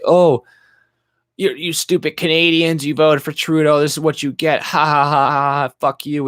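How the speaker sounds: high, loud, jeering troll with fake laughter; mocking commenter. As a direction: sneer at the stupid Canadians. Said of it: "I always get these comments".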